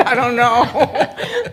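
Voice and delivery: embarrassed laugh while admitting she's unsure. Real, not acted. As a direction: laugh through a sheepish "I don't know". Embarrassed, unsure how to proceed.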